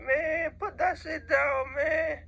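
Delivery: high, slow shouted scolding; annoyed stranger. Retold or imitated. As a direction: yell it like a bothered passerby. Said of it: "random people like".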